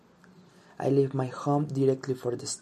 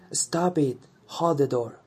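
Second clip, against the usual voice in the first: loud yell to hold the train; retold yell; 2 semitones higher, 6 dB louder than usual. Stranger yelling at the train to hold the door, loud. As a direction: shout it across the platform. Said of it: "Someone yells".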